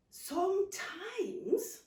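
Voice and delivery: slow, stretched "Sometimes"; drawn-out gentle aunt. Acted. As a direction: ease into advice like a wise aunt.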